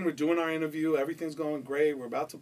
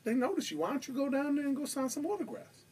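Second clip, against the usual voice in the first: much higher, sly put-on voice; coaxing bodyguard; 12 semitones higher than usual. Bodyguard slyly urging the rapper toward the girls. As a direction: tempt him down to the fans. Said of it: "Pookie goes".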